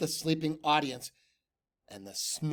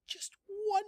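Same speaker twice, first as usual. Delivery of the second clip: nervous plea; 5 dB quieter than usual. A narrator's reading. Nervous Tacky pleading.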